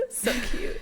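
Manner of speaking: laughing. Real, not acted. Laughing at kids zesting cheese.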